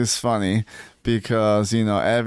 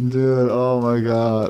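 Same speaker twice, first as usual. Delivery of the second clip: ordinary talk, nothing marked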